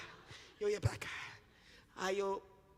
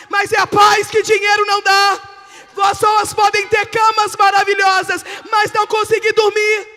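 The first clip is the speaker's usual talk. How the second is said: high, loud proclamation; full-force preaching; 11 semitones higher, 18 dB louder than usual. Real, not acted. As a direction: burst into a shouted sermon.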